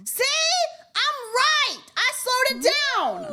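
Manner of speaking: loud, very high insistence; high, loud vindication; triumphant yelling. Real, not acted. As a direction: yell it like you've proved your point. Triumphantly argues with chat.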